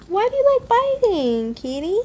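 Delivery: high cooing question to the cat; kitty talk. Real, not acted. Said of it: "the talking kitty talk".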